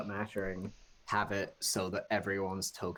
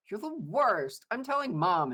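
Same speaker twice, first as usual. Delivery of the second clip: high, whining tattletale; much higher kid voice tattling; whiny little kid; 12 semitones higher, 5 dB louder than usual. Acted. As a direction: whine it up high like a bratty child. Whiny sibling threat.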